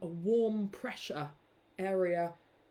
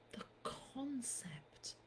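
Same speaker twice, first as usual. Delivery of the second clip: a breathy slow whisper; 4 semitones higher, 15 dB quieter, much slower than usual. Acted. Reciting the stew poem, whispered. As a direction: whisper it like a secret.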